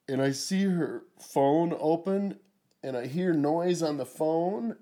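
usual speaking voice

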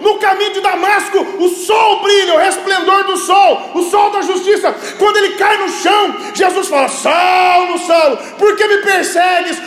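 high, loud, stretched-out pulpit cry; full-force preaching. Real, not acted. Preacher to congregation on Jesus breaking hearts, fervent preaching. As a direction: drive each phrase home at full voice.

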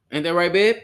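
loud, high call to his partner. Real, not acted. Host calling out to his partner, loud and high. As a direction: call out across the room to babe.

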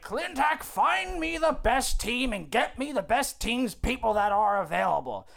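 high, slow demanding holler; tyrant boss. Acted. Boss ordering Clintack to find people, demanding. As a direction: lay down orders with hammed-up bluster.

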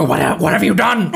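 lightly hammed-up horror; stammered, urgent demand; frantic mock accusation. Dumbledore confronting Harry in the movie, mock frantic.

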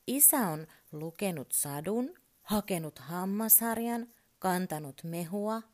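ordinary delivery

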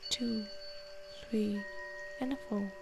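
soft, unhurried counting and calming guidance; guided meditation. Acted. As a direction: talk them gently through each breath. Leads a breathing meditation.

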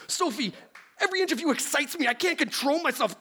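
very high, fast, overexcited nerd; losing control, hammed up; frantic scientist voice. Plays Beekman frantic.

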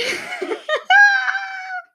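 very high, loud shriek of laughter. Real, not acted. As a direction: let out a squealing laugh.

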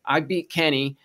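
ordinary delivery

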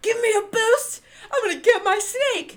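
high, loud retold yell of a furious kid; angry kid yelling. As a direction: yell it like a kid whose snake got thrown away. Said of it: "And I was so angry".

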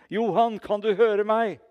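loud, high, urgent call; shouting into his ear. Retold or imitated. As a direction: re-enact calling to a dying man. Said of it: "så ropte jeg inn i øret hans".

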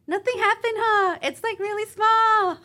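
high, loud ditzy mimicry; giggly girl. Retelling girl who hit the car downplaying it, high giggly imitation. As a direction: imitate a giggly driver brushing it off.

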